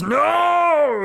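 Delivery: long, high, loud scream of refusal; drawn-out panicked shout; freaked-out yell. Retold or imitated. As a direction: cry out as if suddenly losing it. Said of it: "he just freaks out and goes".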